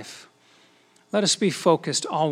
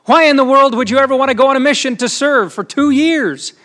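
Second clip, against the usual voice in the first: higher and louder, openly disbelieving; incredulous challenge; 8 semitones higher, 7 dB louder than usual. Retold or imitated. A reporter to young Les, higher and louder in disbelief. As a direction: push him hard, can't believe anyone would choose this.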